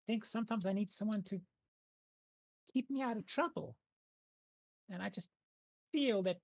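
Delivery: high, hesitant tree-spirit voice; small high creature, haltingly explaining itself. Acted. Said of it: "it's gonna kind of say to you".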